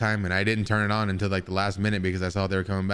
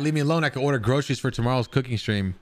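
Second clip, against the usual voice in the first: ordinary talk, nothing marked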